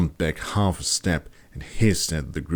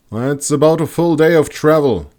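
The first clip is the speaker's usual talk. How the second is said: high and loud call down; 9 semitones higher, 8 dB louder than usual. A narrator's reading. Heinrich in a tree calling down to group, loud. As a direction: call down from the treetop. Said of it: "he called from up high".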